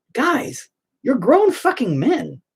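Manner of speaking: exasperated scolding. Retold or imitated. Retold scolding of commenters.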